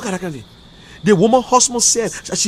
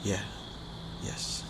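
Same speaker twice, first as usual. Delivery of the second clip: stunned quiet yes; 11 semitones lower, 17 dB quieter, much slower than usual. Retold or imitated. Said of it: "I say".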